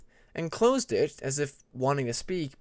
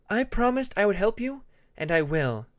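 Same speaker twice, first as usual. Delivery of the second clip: higher, firm promise; insisting; 7 semitones higher, 3 dB louder than usual. A narrator's reading. Tempest reassuring Peacemaker she will help him, firmly insisting. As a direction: press the promise home, firm and sure. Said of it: "Tempest insisted".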